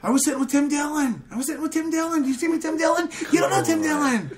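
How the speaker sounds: 模仿史蒂维，高声尖叫; high mocking Stevie voice. Imitating Stevie, high and shouting.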